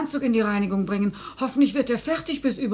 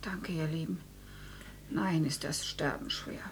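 very soft, low, spent voice; dying weakly; 6 semitones lower, 12 dB quieter than usual. Acted. Dying mother thanking family once laid down, weak and spent. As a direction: let the strength drain away.

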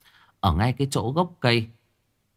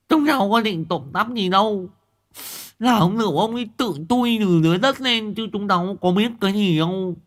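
put-on high, whiny, pleading kid with a lisp; whimpering lisping boy; 12 semitones higher, 4 dB louder than usual. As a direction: beg the old man, half crying. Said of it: "ú ớ mếu máo". (thằng Quý ngọng mếu máo van xin, giọng cao)

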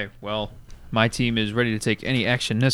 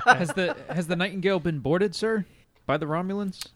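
laughing; 5 semitones higher, 5 dB quieter than usual. Real, not acted. Laughing while asking the captain.

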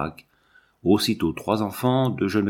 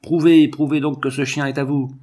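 higher, louder demand; crowd shouting; 5 semitones higher, 5 dB louder than usual. A narrator's reading. Bystanders demanding proof from Jean, shouting. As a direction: call out a challenge, moderately raised. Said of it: "gens qui criaient".

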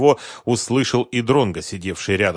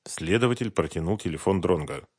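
plain reading voice

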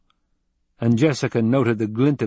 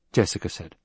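usual speaking voice

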